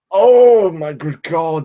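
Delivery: loud, high, drawn-out rapture; swooning, slow and emphatic; gushing enthusiasm. Real, not acted. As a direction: gush over a beloved book. Gushing over favourite novel Lolita.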